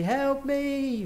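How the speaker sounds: high, sustained mock plea; lightly acted high-pitched appeal; pleading. Shown as a demonstration. Demonstrating a plea for help.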